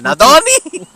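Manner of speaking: a baffled high question, Nadoni?; confused Nadoni. Retold or imitated. Host retells his puzzled reaction to the name, high question. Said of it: "I'm like".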